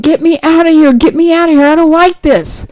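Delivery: half-acted cup yelling to be let out; screaming cup. Cup in the kiln begging the potter, high mock scream. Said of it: "that cup is screaming".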